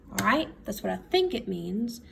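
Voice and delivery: ordinary talk, nothing marked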